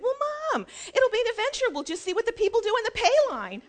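high-pitched imitation of a chirpy daughter; upbeat daughter. Daughter to mother who forgot the toll pass, chirpy and high.

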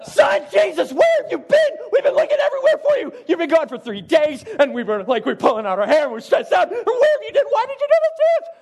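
high, loud, laughing frantic mom; frantic mother. Pretend. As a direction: shriek like a panicked parent who lost her kid. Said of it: "No, this is a".

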